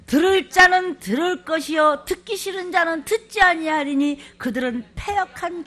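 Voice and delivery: high, loud scripture reading; loud proclamation. Acted. Pastor proclaiming who will hear, loudly. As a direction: ring it out over the crowd.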